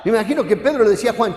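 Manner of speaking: ordinary delivery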